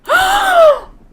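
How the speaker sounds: a sharp, high, loud gasp of disbelief; shocked gasp. Real, not acted. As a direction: gasp as the result appears. Seeing the passing results.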